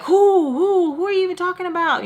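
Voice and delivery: high, loud imitation of an owl; owl-like hooting. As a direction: hoot the words in a high voice. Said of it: "sounding like a f-, now".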